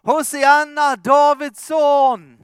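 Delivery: high, loud jubilant shouts of the crowd; crowd shouting. Acted. Preacher voicing the crowd hailing Jesus, loud and jubilant. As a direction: cry it out like a cheering crowd hailing a king. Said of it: "Och de ropar".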